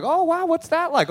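mock-enthusiastic passenger chatting up the driver; overeager passenger. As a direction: play the polite rider fishing for small talk. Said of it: "And you're like".

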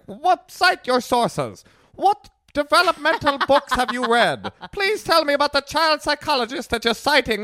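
high, mocking Scottish voice; mock Scottish accent. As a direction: do a haughty Scottish skeptic. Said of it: "I don't know why you became Scottish".

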